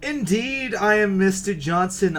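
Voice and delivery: high, pompous client voice; Mister Johnson voice. Acted. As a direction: announce yourself grandly.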